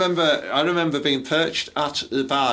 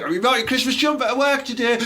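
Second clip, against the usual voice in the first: higher, mocking colleague voice; mock office lad; 11 semitones higher, faster than usual. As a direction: ham up a keen coworker.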